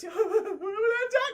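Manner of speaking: high, slow, hammed-up sobbing; mock crying. Retelling Mac weeping to the moon over his friend, mock crying. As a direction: wail it through pretend tears. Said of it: "He's just crying".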